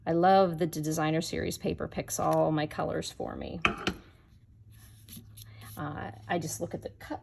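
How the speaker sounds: usual speaking voice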